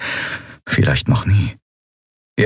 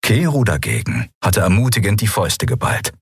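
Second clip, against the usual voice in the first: usual speaking voice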